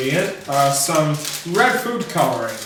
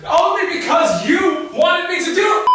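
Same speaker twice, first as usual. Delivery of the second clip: shouting; 13 semitones higher than usual. Acted. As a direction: yell it back, high. Banter shout.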